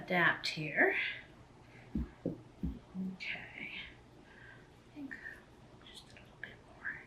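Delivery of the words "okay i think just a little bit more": very soft unvoiced murmur while busy; whisper. Real, not acted. A cook measuring under her breath, whispered. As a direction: breathe the words almost silently. Quiet muttering while working.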